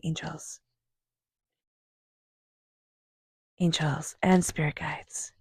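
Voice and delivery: slow breathy whisper to the angels; whispered invocation. Real, not acted. Reader whispering to her spirit guides mid-shuffle, slow whisper. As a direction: whisper the call, barely there.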